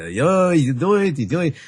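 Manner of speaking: a hint of a formal frum Hebrew accent; imitating the frum accent of the people he grew up with. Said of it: "very formal, frum, kind of accent".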